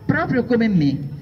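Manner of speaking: ordinary delivery, nothing marked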